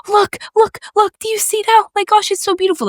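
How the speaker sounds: high-pitched mock marveling at the view; excited wonder. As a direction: imitate a thrilled sightseer, voice way up. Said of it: "she was so excited about everything".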